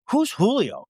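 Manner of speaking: put-on high, puzzled kid voice; imitating his son. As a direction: play the son asking who that is. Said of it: "and he goes".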